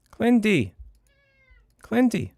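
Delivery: high, loud call across the room; calling the cat. Real, not acted. Calling his cat Clinty across the room, loud and high. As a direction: call him like you want him now.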